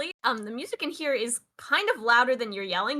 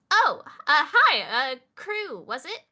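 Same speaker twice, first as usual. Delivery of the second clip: Ashlyn voice; 5 semitones higher, 6 dB louder, slower than usual. Acted. GM voices Ashlyn greeting Crew.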